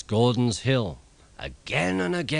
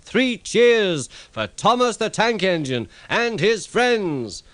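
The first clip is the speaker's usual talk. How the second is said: much higher, louder call; shouted cheer; 10 semitones higher, 4 dB louder than usual. A narrator's reading. Fat Controller calling for cheers for Thomas, loud shout. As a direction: rouse the crowd to cheer. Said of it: "the Fat Controller shouted".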